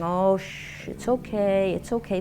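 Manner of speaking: put-on hushed, gentle soothing to a baby; soft soothing shush. Parent calming a screaming baby, hushed and soothing. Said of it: "rubbing the baby's back going".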